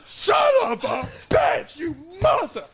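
high raging curse; furious shout. Retold or imitated. Driver cursing the rock that shattered the windshield. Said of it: "I'm driving like this".

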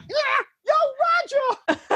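very high hollering call; excited fan shout. Retold or imitated. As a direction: holler a name from a car. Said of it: "stop in a car".

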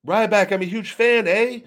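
high, loud mock Canadian accent with a big eh; Canadian fan accent. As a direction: ham up a Canadian fan greeting you. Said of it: "they're like".

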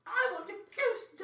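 high, slow, whiny screech; spoiled Veruca Salt. Pretend. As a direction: whine like a spoiled rich girl. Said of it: "sounds like the original drag queen's name".

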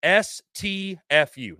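very slow, letter by letter; deadpan continuation of slow dictation; mock-slow dictation. Real, not acted. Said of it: "say it slower for you".

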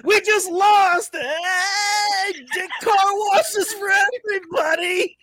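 high, loud mock-triumphant cheer; proud player mock cheer. A player cheering hazing after a loss, high mock cheer. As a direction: crow it like a proud winner. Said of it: "who's the guy who's proud to be like".

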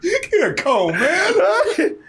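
high laughing jeer; mock him through laughter. Real, not acted. Laughing mockery.

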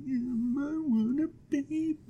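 tiny, high, soft pouting voice; mock sad. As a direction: pout it very softly. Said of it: "why are you so sad?".

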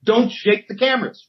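high, sharp warning call; high-pitched retold warning; mock cameraman warning. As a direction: bark a warning at the guy behind you. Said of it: "Both cameramen turn around and say".

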